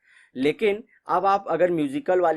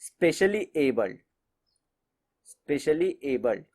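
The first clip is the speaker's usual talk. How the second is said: Specially abled said slowly, each word laid out and repeated; slow deliberate emphasis; slower than usual. Shown as a demonstration. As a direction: spell out specially abled slowly to make it stick. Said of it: "prime minister ने बोला इनको disable मत बोलिए". (धीरे और ज़ोर देकर specially abled)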